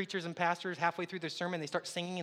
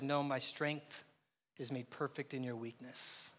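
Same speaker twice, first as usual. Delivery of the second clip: slow and much softer, quoting God; solemn divine answer; 5 semitones lower, 7 dB quieter, slower than usual. Retold or imitated. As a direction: lay it down gently, like a final word. God's answer quoted solemnly.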